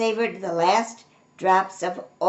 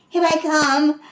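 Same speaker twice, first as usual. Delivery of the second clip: higher, louder eager shout; excited cry; 8 semitones higher, 7 dB louder than usual. A narrator's reading. Cub crying out as he joins the chase. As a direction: burst out as you charge in. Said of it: "cried Binky".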